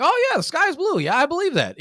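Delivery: high, loud, sing-song agreement; mock agreeable listener. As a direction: gush like you totally buy it. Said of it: "you're like".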